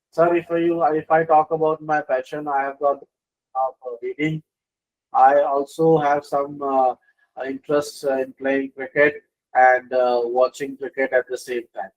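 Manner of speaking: low, hesitant interview answer; unassertive. Shown as a demonstration. Candidate telling interviewer about passions, unassertive. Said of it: "tone doesn't have assertiveness".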